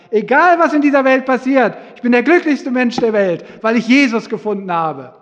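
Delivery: high and loud, joyful; preaching at full force. Acted. Preacher promising the congregation joy, loud and high. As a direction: lift the whole room with joy.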